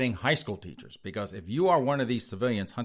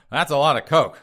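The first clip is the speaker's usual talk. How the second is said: emphatic exclaim; 5 semitones higher, 11 dB louder than usual. Real, not acted. Exclaiming at cocaine haul.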